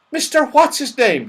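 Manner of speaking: high, loud surprised cry. Acted. Saucepan man hearing his friend's name, crying out. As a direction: exclaim the name high and eagerly. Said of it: "he cried".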